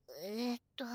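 whispered hesitation; whispering. Real, not acted. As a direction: breathe it, unsure.

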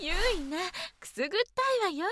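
high, quick giggly protest; ticklish protest. Acted. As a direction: squirm and protest, half laughing. Mother tickled while washed.

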